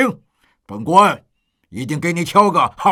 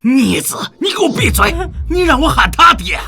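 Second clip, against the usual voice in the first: indignant reprimand climbing in pitch; outraged scolding; 4 semitones higher than usual. Acted. Father scolding his unfilial son, outraged shout. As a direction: start firm, then rise to an angry shout.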